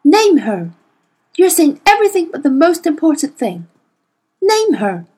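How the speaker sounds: loud, insistent demand; urgent cry. A narrator's reading. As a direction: demand the name impatiently. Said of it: "cried Missus Coulter".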